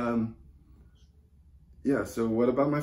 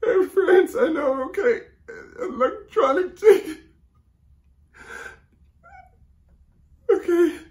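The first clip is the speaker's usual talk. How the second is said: very high, tearful squeak; crying; 4 dB louder than usual. Acted. Bereaved caller repeating flight details, crying. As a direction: force the words out through sobs. Breaking down taking travel details.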